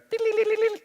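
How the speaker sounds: very high, tinkly fairy trill. Shown as a demonstration. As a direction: flutter the sound up high like a tiny fairy. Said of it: "The fairy goes".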